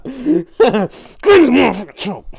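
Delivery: high shout broken up by laughter; laughing yell. Retold or imitated. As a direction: yell it while cracking up. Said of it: "It's like".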